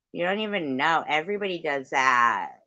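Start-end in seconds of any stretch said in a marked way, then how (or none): none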